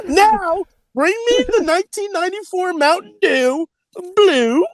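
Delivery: high imperious demand; pampered star commanding an assistant; diva order. Pretend. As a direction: order it like a spoiled celebrity.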